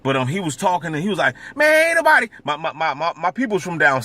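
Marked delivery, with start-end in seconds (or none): 1.6-2.3 high, loud imitation of his uncle; imitating the loud uncle, high and louder; loud southern uncle. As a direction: mimic the uncle's loud Alabama way of talking. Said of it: "he be talking real loud".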